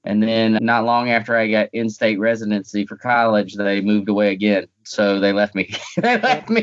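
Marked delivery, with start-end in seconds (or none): 5.8-6.6 much higher, with a laugh; bursting into a laugh on the repeat. Real, not acted. Guest laughing as he repeats that his parents left him.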